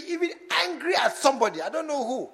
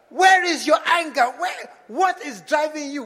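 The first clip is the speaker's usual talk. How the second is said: shouted, higher "Where is your anger?"; loud challenge; 5 semitones higher, 10 dB louder than usual. Real, not acted. Preacher shouting at the congregation, a loud challenge. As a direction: call them out loudly.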